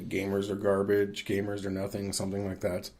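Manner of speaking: usual speaking voice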